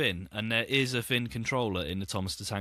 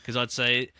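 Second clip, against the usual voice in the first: ordinary delivery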